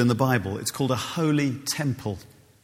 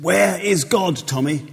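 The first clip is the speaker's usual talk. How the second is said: higher, louder insistent vicar; stern vicar; 6 semitones higher, 4 dB louder, slower than usual. Retold or imitated. Vicar repeating his question to the frightened boy, insistent. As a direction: press the question harder. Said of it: "he leaned up and said".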